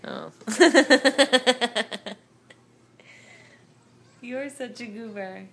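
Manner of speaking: ordinary talk, nothing marked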